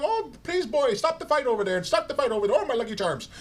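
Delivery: high-pitched mock Irish woman; Irish accent. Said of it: "in her Irish accent".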